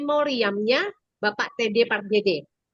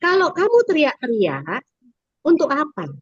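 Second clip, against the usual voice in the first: put-on teacher questioning child; 4 semitones higher, 4 dB louder than usual. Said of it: "Saya bilang".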